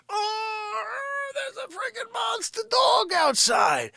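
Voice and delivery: high, loud outbursts with quick drops; mock outrage climbing to a shrieked alarm; indignant alter-ego character. Character scolding Maggie for eating a building, hammed-up indignation.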